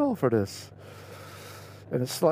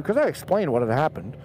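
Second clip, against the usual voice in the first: ordinary delivery, nothing marked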